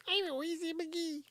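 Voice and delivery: tiny, very high mimicking voice; mocking character voice. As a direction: squeak it softly, making fun of her. Mock voice for Joanne, then answered.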